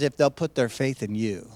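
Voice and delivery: low, quiet voice of God answering; voicing God's answer. Preacher voices God's promise, low and quiet. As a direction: speak as God giving the promise. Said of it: "God's answer is".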